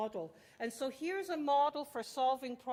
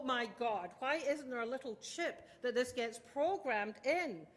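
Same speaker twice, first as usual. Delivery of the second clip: ordinary delivery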